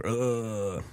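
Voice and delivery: a quiet groan of stomach pain. Retold or imitated. Sick at his desk, groaning softly in pain. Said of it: "Of course I was in pain".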